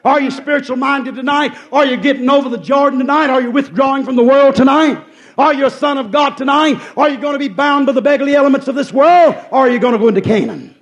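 high, loud volley of challenges; full-force preaching. Real, not acted. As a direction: press the congregation hard, question after question.

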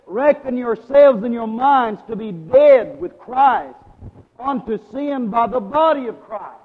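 loud, slow scripture declamation; preaching. Real, not acted. Preacher quoting Paul to congregation, forceful preaching. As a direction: proclaim the verse with drawn-out weight.

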